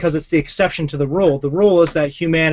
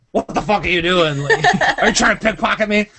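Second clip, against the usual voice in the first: mock angry guy, laughing; 4 semitones higher than usual. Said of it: "be like".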